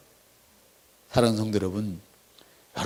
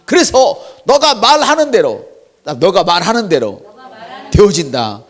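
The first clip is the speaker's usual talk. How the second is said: fervent preaching; 6 semitones higher, 7 dB louder than usual. Acted. Preaching at full force.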